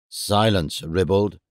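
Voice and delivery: low, imperious rebuke; commanding. A narrator's reading. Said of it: "commanded the Queen".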